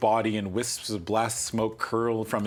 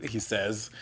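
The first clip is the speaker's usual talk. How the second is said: ordinary delivery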